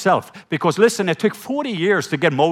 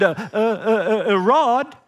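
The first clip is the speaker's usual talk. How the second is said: stammering Moses; 5 semitones higher, slower than usual. Retold or imitated. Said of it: "He said".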